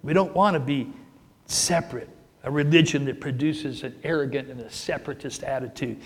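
normal talking voice

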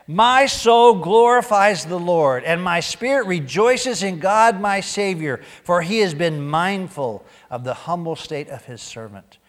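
preached scripture. Acted. Reads Magnificat with full force.

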